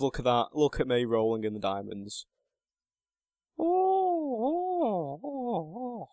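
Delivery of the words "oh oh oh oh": high, drawn-out falling oohs; crooning oohs. Acted. Gloating over found diamonds.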